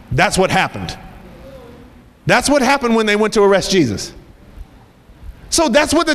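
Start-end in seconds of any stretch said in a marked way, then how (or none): none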